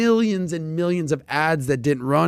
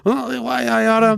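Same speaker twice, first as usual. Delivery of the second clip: put-on old man voice; 7 semitones higher than usual. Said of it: "your old man impression".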